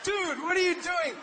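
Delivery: high, baffled what are you doing; confused victim. Retold or imitated. Victim questioning the Indian robber, high confused disbelief. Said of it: "You'd be like".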